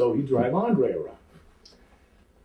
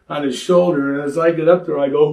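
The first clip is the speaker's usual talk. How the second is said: ordinary talk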